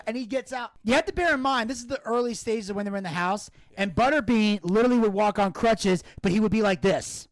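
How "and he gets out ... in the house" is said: ordinary delivery, nothing marked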